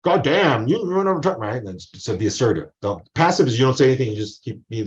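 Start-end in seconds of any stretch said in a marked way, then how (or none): 0.0-1.4 mildly acted aggressive outburst. Student complaining to professor about going over time, mock-aggressive. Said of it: "Don't be aggressive, like".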